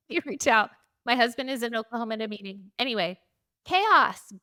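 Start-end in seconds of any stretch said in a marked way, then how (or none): 3.7-4.3 loud, high exclamation; exclaimed. Real, not acted. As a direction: burst out with it. Exclaimed summary word.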